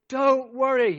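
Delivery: high, loud ringing command; trumpet-blast command. Acted. Preacher thundering Jesus's command at the congregation. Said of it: "it's like getting a trumpet blast".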